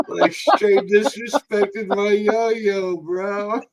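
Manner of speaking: words through laughter; high, slow laugh-talk. Real, not acted.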